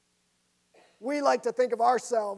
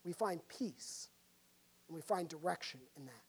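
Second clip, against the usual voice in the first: quiet reassurance; 5 semitones lower, 12 dB quieter than usual. Real, not acted. Preacher softens for comfort.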